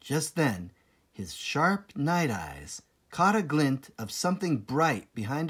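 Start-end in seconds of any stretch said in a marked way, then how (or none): none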